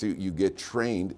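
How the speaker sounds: ordinary delivery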